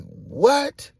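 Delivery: loud, high-pitched "what"; one yelled question of disbelief; shocked. Retold or imitated. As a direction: voice leaps up in shock. Reacting to shocking news.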